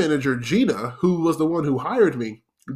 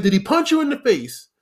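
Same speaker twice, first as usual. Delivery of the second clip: sharp, much higher angry question; scolding manager; 10 semitones higher than usual. Retold or imitated. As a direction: fire it back at the server, pitched way up. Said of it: "He said".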